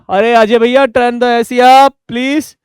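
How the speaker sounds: loud, high call across the room; calling to a colleague. Acted. Teacher calls out loudly to Ajay to turn up the AC. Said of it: "थोड़ी गर्मी लग रही है".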